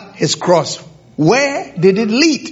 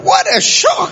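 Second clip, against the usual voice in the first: much higher, laughing exclamation of surprise; a joyful laughing gasp of surprise; delighted surprise. Real, not acted. Spots Yehuda in the congregation.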